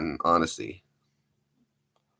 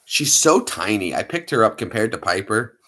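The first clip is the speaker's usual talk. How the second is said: ordinary delivery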